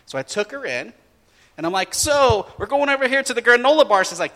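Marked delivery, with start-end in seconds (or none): 1.9-4.0 bright-eyed dad leading the way, higher and quick; high, rushed, louder pitch to the daughter; upbeat dad. Retold or imitated. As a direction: announce it eagerly to a kid. Dad steering daughter to snack.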